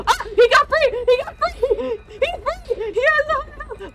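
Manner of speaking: very high, frantic shrieks; panicked screaming. Real, not acted. Player seeing the stuck monster get free, shrieking in panic. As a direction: shriek it in real alarm.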